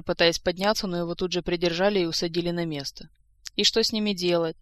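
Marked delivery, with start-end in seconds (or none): none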